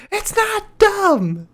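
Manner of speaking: very high, slow squeaky yell. Shown as a demonstration. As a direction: squeak it out like the Keebler Elf. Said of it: "a higher pitched voice".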